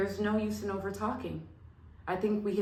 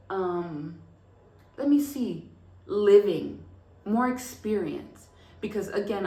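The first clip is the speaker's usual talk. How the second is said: normal talking voice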